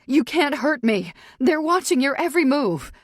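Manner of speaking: high, loud panicked warning; frightened defiance. Acted. Captive warning her attacker, frightened defiance. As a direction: shout a desperate threat to back him off.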